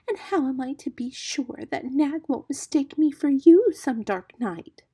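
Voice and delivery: high, plaintive muskrat voice, slowing at the end; very sorrowful. A narrator's reading. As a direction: fret aloud, pitiful and worried. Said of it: "more sorrowfully than ever".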